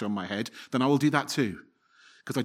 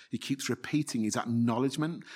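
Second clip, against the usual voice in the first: normal talking voice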